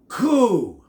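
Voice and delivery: loud high coo. A narrator's reading. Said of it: "puckered his lips and said".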